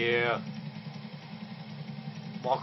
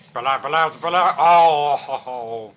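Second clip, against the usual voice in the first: louder, rising alarm; yelling as it falls; alarmed shouting; 9 dB louder, slower than usual. Real, not acted. Gamer watching the helicopter crash, louder.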